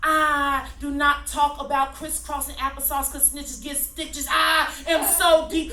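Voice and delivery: loud, driving spoken-word rant; hammed-up slam-poet intensity; fired-up slam poetry. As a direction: preach the nonsense at full force. Shouts the parody poem.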